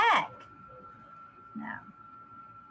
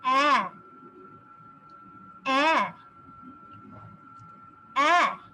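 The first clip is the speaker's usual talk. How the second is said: big a sound. Shown as a demonstration. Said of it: "let's have this one".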